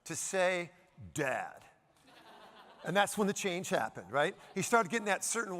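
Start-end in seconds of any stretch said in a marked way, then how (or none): 1.1-1.6 low, flat, pointedly cool "Dad."; deliberate grown-up Dad. Retold or imitated. Son dropping Daddy for Dad, cool low "Dad.". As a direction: drop the voice to a cool teen "Dad". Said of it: "he made a concerted effort to say".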